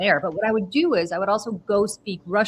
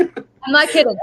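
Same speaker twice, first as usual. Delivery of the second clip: laughing; 8 semitones higher, 9 dB louder, slower than usual. Real, not acted. Insisting while laughing.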